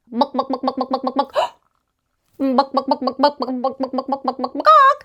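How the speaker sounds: clucking like a hen; chicken clucking. Pretend.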